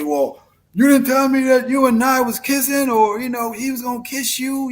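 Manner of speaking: mock jealous partner complaining about a kiss; mock complaint. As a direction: put on a whiny accusing voice. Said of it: "I didn't say".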